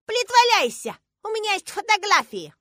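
put-on very high, loud childish voice; indignant little girl. A narrator's reading. Girl contradicting her father with proof, indignant. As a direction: accuse him like an outraged small child.

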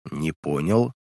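low, soft, gloomy reply; understated glum objection, dropped low; sullen response. A narrator's reading. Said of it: "Хмуро отозвался я".

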